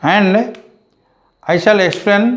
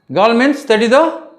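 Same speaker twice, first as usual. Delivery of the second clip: ordinary delivery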